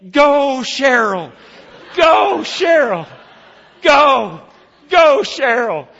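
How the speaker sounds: loud, high rallying chant; crowd cheering. Retold or imitated. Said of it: "other people begin to say".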